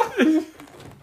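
high, loud burst of laughter; laughing. Real, not acted. Podcaster after his crude slogan, laughing. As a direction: crack up loudly at your own joke.

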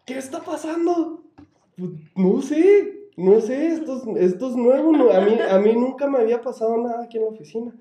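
high, loud frightened babble; panicked. Retold or imitated. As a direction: talk fast and scared. Both panicking about noises.

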